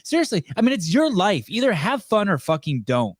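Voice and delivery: high, gushing over-the-top enthusiasm; hyped executive. Pretend. Mocking a Coca-Cola exec's team-building trip, giddy high gushing. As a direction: play a corporate exec raving about it.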